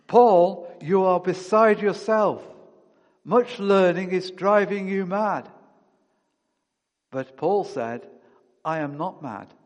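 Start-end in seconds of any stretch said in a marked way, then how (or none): 0.1-5.5 loud, higher accusing cry. A narrator's reading. Festus shouting at Paul in court, loud accusing voice. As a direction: call it out loudly at Paul. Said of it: "Festus said with a loud voice".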